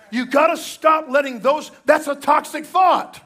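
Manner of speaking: forceful preacher; emphatic preaching. Real, not acted. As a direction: push it hard. Preacher rebukes toxic thoughts.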